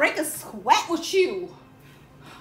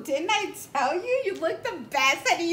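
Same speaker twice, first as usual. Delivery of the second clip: normal talking voice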